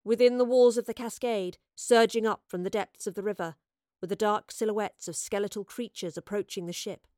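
usual speaking voice

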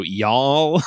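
a hint of a Southern accent on Y'all; mock Southern drawl. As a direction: tack on a lazy Southern Y'all. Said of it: "and then he'll just go".

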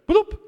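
high, loud imitated notification bloop; computer bloop. Imitating the Skype message sound aloud. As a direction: pop it out like a chat alert. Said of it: "My computer goes".

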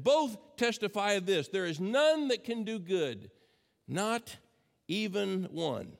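ordinary talk

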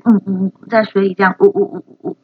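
screams held in behind closed lips; muffled screaming. Shown as a demonstration. Teacher demoing a worm screaming underwater, muffled. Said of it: "muffled screaming".

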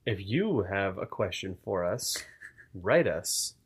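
Radio Lab style read. Pretend. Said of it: "That was our, like, shitty Radio Lab sequence".

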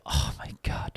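hushed, whispered aside; whispered thought. Retold or imitated. Podcaster retelling what he thought to himself, whispered. Horrified thought at being recognised.

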